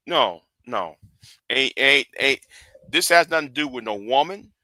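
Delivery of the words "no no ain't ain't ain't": ordinary delivery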